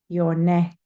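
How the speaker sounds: normal talking voice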